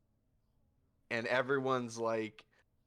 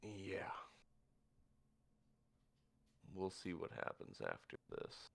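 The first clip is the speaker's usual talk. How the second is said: low, much softer, noncommittal; quiet reply; 3 semitones lower, 13 dB quieter than usual. Acted. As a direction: murmur it, holding back. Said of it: "He just looks at her".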